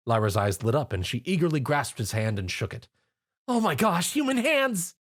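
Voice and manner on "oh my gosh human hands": high fangirl squeal; squeeing. A narrator's reading. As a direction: squeal with delight. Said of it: "Lyra squeed".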